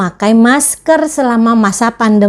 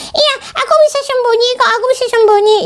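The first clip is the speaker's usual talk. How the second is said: put-on much higher childlike puppet voice; puppet friend; 13 semitones higher, much faster than usual. Teacher voicing her puppet for the children, high puppet voice. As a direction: play the cheeky puppet sidekick, pitched way up.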